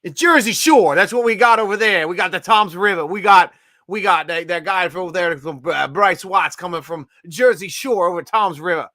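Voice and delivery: hammed-up New Jersey accent. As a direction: put on a brash Jersey Shore voice. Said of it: "is that your Jersey accent?".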